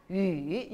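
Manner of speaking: 유 dropped low, then lifting; third tone. Shown as a demonstration. Teacher models third tone for students, 유 dipping low. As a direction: dip 유 down and up. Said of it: "삼성으로 해보세요".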